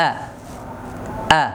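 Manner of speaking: আ said short with zabar; clipped single vowel for teaching; short vowel demo. Shown as a demonstration. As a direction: say a brief, clean আ. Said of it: "জবর হলে কেমন হবে".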